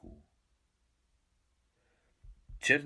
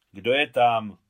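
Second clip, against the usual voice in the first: calling out; 11 dB louder, slower than usual. A narrator's reading. Mother calls through the window.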